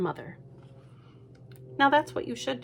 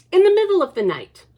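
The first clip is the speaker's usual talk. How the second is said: higher, louder and quick; dramatic opening; 10 semitones higher, 10 dB louder, faster than usual. A narrator's reading. Storyteller building suspense. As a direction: launch the spooky scene.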